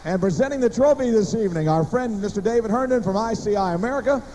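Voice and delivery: ordinary delivery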